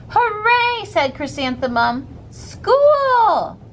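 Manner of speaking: high, bright cry of joy; excited cheer. Acted. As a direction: shout it out, thrilled to start school. Girl running to first school day.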